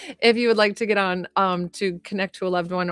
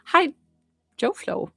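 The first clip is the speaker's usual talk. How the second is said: funny voice; 10 semitones higher than usual. Real, not acted. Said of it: "I wanna say that really funny".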